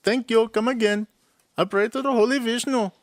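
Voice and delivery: a hint of an Indian shopkeeper accent; Indian accent impression. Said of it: "my Indian voice".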